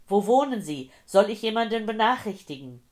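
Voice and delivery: very loud, high, pressing questions; urgent shouting. A narrator's reading. Old man to injured, groaning woman, shouting urgently. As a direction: shout questions to keep someone conscious. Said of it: "seine Schreie taten ihr weh".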